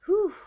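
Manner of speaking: loud puff of breath; breathy whew. Real, not acted. Vlogger catching her breath mid-intro, exhaled whew. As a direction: blow out a big breath to recover. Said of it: "I'm running out of breath".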